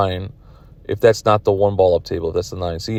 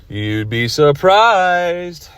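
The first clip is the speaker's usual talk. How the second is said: teasing; 8 semitones higher, much slower than usual. Real, not acted. Teasing answer to own question.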